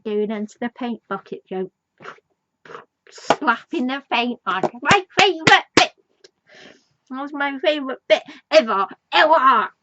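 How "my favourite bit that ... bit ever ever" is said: much higher and louder, real delight; excited gush. Real, not acted. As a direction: squeal about the best bit. Gushing about her favourite scene.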